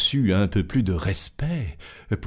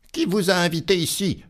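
irritated tailor; 10 semitones higher, faster than usual. Acted. Tailor shooing flies.

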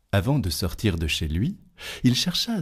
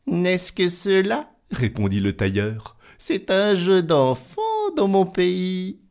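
scoffing tailor; 11 semitones higher than usual. Acted. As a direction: brush it off as child's play.

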